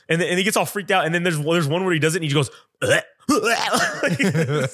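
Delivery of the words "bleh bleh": higher mock bleh noises, laughing; weird imitated bleh. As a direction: blurt out silly weird noises. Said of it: "stupid weird shit like that".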